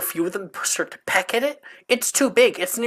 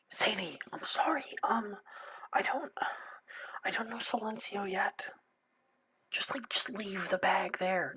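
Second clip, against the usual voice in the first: put-on high, hushed apologetic girl; whispered character voice; 5 semitones higher, 12 dB quieter than usual.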